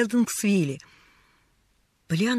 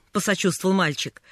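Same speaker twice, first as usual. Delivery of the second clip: ordinary delivery, nothing marked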